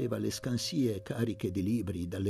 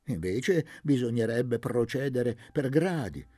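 higher, lively pontificating; eccentric old man; 7 semitones higher than usual. A narrator's reading. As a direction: lecture with proud zeal. Don Benedetto filosofeggia. (vecchio erudito entusiasta, voce alta)

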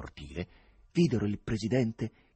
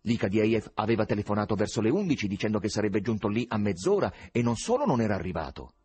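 usual speaking voice

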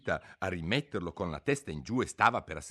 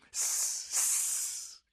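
hushing whisper. A narrator's reading. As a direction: hush her urgently. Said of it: "disse il coniglio più piano che poté".

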